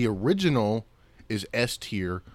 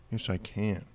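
usual speaking voice

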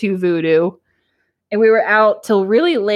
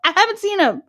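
high, insistent retold complaint; exasperated protest; 9 semitones higher than usual. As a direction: insist once more, pitched way up. Exasperated reply repeated to aunt.

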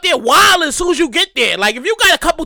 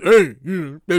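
smug brother; 10 semitones lower, slower than usual. Retold or imitated. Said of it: "He's like".

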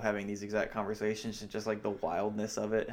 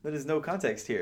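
higher-pitched mock bafflement; exasperated disbelief; 6 semitones higher, faster than usual. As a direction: retell it as a baffled, exasperated objection. Acting out the dinner argument.

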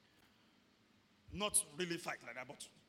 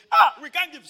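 wife's protest; 8 semitones higher, 21 dB louder than usual. Retold or imitated. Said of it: "I'll tell my wife".

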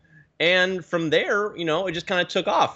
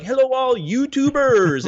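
jovial, excited YouTuber greeting, high and drawn out; New York Steelo; 10 semitones higher, 4 dB louder, slower than usual. Retold or imitated. Teacher imitating his favorite YouTuber's greeting, jovial and excited. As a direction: imitate an exuberant, accented video intro, slow and loud-ish. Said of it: "he had this accent ... super jovial and excited".

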